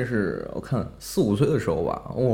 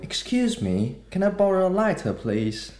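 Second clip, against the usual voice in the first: put-on posh British accent; 8 semitones higher than usual. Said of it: "很高贵的英国腔".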